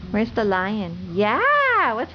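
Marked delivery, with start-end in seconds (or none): 1.1-1.9 excited cheer. Real, not acted. Cheering toddler.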